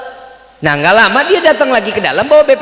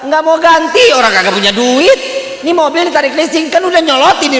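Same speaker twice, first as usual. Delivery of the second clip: high, loud mock-indignant outburst; retold exasperation; 9 semitones higher, 5 dB louder than usual. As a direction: act out an irritated inner rant. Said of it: "juga kesel gue nih".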